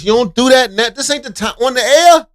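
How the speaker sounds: high, loud mock scolding. Co-host mock-scolds Ebro.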